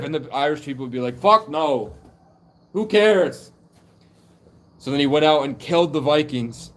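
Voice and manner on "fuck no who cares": loud, raised-pitch refusal; dismissive yell. Retold or imitated. Irish people refusing the giant's plea, yelling dismissively. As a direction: throw it back at him loudly.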